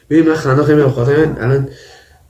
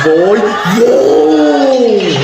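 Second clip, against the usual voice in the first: slow, high, loud drawn-out wail; stretched shout; 7 semitones higher, 3 dB louder, much slower than usual. Real, not acted. Shouting at clip.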